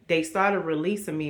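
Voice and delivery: ordinary delivery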